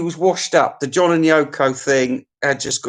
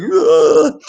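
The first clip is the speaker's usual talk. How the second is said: mock disgusted grunt; 6 semitones higher, 6 dB louder than usual.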